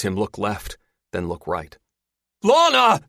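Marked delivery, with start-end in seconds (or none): 2.4-3.0 high, loud desperate call; shout. A narrator's reading. Father searching for daughter fallen overboard, shouting. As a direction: shout for a lost child. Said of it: "he shouts".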